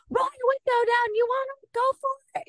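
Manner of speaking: high, challenging taunt at the driver; mock road rage. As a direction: dare him to pull over, hammed up.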